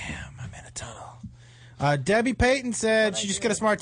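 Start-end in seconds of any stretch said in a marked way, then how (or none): 0.0-1.0 hushed unvoiced whisper. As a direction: whisper it like a secret. Joking about sounding in tunnel.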